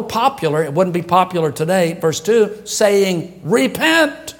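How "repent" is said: mock prophet cry. Preacher voicing John's cry.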